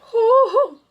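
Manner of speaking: high, loud "hoo-hoo" whoop; excited hooting. Real, not acted. Reader reacting to a juicy romance blurb, excited hooting.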